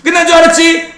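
high, playful gotcha; spring it on them in a high voice; teasing gotcha. Pretend. Teacher springing another item on class, teasing gotcha. Mock gotcha as list keeps growing.

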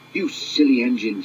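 ordinary delivery, nothing marked

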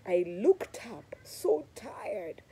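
slow breathless whisper; exhausted whisper. Retold or imitated. Worn-out hiker telling listeners she looked up, whispered. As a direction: whisper it, spent from the climb.

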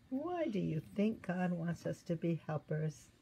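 ordinary delivery, nothing marked

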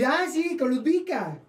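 high, gushing exclamation; mock excited yell. Storyteller gushing to the director about a star, mock excited yell. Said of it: "lo grité porque es estreno".

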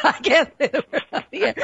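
real giggle through quick words; laughing. Real, not acted. Host admitting she can't swear on air, laughing. Laughing at censoring her own story.

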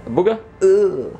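loud high yuck; disgusted. Real, not acted. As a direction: recoil in disgust. Dog has booger.

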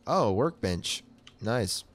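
normal talking voice

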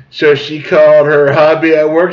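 usual speaking voice

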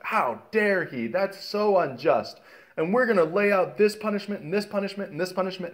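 hammed-up righteous indignation; mock indignation. David fuming at the man who stole the lamb, mock outrage. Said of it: "David has some righteous indignation".

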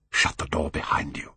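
a soft, secretive order; whispered. A narrator's reading. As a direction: hush it so no one hears. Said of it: "he whispered".

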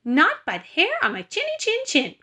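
high-pitched piggy cry; crying out as little pig. A narrator's reading. Second pig refuses the wolf, high piggy cry. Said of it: "cried the second little pig".

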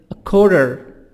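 American-accented 'a quarter'; American pronunciation. Shown as a demonstration. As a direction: say QUARTER as 'kworder', American style.

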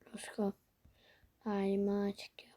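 unvoiced whisper. Real, not acted. Gamer whispering to viewers while sneaking. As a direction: whisper so enemies won't hear.

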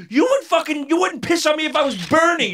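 shouting him down, high and loud; angry retort. Retold or imitated. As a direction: hurl it back at him, furious.

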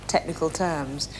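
normal talking voice

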